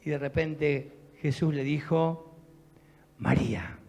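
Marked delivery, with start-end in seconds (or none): 3.2-3.8 low, soft calling of her name; tender call. Acted. Jesus gently calling Mary by name at the tomb, soft and low. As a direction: say her name softly, like a loving recognition.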